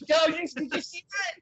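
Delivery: high, eager question to a friend; bursting to share the moment; excited. Real, not acted. Excited about the anime scene.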